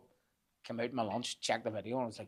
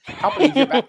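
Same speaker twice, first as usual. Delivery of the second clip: higher and louder, laughing through it; laughing disbelief; 13 semitones higher, 11 dB louder than usual. Retold or imitated. Deli girl laughing at the grumpy man coming back, laughing loud. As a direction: laugh the words out at him.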